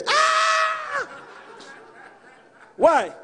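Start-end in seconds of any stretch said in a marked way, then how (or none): 0.1-1.1 sudden high shriek of shock; startled scream. Pretend. Churchgoer shrieks at talking dog, sudden high scream. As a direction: scream as if the dog just spoke.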